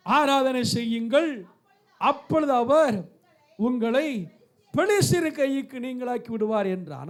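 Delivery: measured, ringing scripture recital; scripture reading. Acted. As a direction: proclaim the verse to a congregation, pausing between phrases. Reads the Bible passage aloud.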